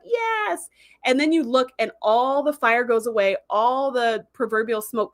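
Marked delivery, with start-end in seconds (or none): 0.0-0.7 high, drawn-out mock cheer; high-pitched celebratory yes. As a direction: imitate a jubilant crew, without shouting. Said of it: "they're all cheering and they're like".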